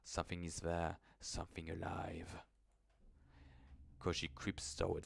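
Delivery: low, soft storytelling voice; hushed horror narration. A narrator's reading. Narrator setting a creeping horror scene, hushed. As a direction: draw the listener into the dark.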